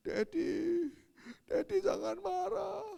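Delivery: put-on high, pleading kid voice; mock whimpering little one; imitated tearful child. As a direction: plead with father, close to tears. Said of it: "Sudah nangis dia".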